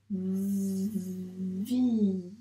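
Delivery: "Vi" with a very long v, then held i; sounded-out syllable. Shown as a demonstration. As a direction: buzz the v of "Vi" slowly as if writing it. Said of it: "donc on doit le dire, les sons".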